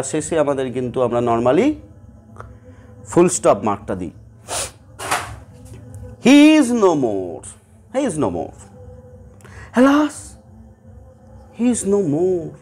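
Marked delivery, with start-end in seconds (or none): 6.2-12.5 slow, mournful lament with a pained alas; grieving. Shown as a demonstration. Teacher demonstrating grief in a sentence, mournful lament. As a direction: mourn a death, drawn out. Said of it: "আমার মনে যে grief, যে কষ্ট".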